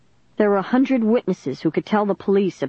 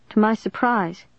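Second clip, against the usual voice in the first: plain reading voice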